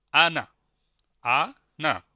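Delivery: loud, deliberate "আনা", syllables clearly separated; repeat-after-me model. Shown as a demonstration. Teacher asking learners to say along, clear syllabic "আনা". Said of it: "আপনারা তাহলে আমাদের সাথে বলেন".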